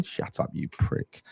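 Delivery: slow whisper of the thought in his head. Retold or imitated. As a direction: hiss it under your breath like a private thought. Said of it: "I was thinking".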